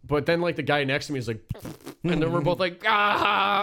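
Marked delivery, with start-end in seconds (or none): 2.9-3.6 loud, high drawn-out "ah"; mock a shared outburst, high and loud; imitated outburst. Retells both men's shared outburst.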